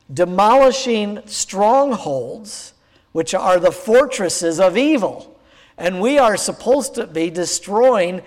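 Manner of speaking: ordinary delivery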